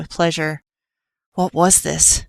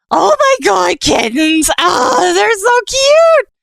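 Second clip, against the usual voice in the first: much higher, loud squeal, cute drawn out slow; excited shriek; 6 dB louder, slower than usual. Acted. Killer squeals with delight at the kittens, loud and high. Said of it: "cried Killer".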